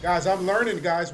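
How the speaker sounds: excited cheer. Real, not acted. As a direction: announce it loudly, proud of yourself. Celebrating progress to chat.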